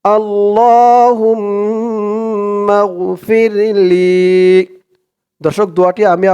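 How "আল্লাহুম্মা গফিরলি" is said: slow, higher chanted Arabic recitation; melodic recitation. Acted. Teacher recites the dua to viewers in slow chant. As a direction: draw each word out like tilawat.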